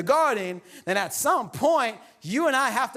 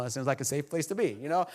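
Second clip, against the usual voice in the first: ordinary talk, nothing marked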